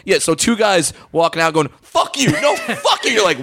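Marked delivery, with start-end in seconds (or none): 1.8-3.1 squeaky giggling fake fight; high laughing mock insult. Imagined bickering guys.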